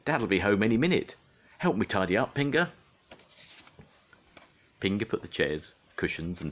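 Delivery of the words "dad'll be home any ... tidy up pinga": high, loud and flustered; panicked urging. A narrator's reading. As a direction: urge someone to hurry before trouble comes. Panicked hurry before Dad returns.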